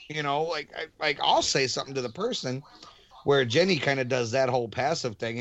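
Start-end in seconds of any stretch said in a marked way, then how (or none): none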